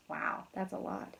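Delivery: ordinary delivery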